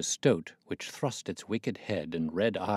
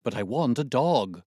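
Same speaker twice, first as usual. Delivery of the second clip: raised, louder plea; cried out; 5 semitones higher, 6 dB louder than usual. A narrator's reading. Watson calls up to the old man at the window, raised voice. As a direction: call it up to the window. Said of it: "I cried".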